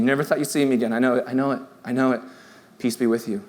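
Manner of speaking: low, soft, hushed reassurance; gentle God. Acted. God comforting frightened followers in chaos, gently reassuring. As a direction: speak low and softly, soothing someone in chaos.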